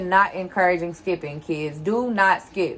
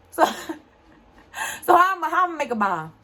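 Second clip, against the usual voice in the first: laughing through it, higher and fast; 9 semitones higher, much faster than usual. Real, not acted. Vlogger laughing at the bomb idea, laughing and fast. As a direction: chuckle out the question at the absurdity.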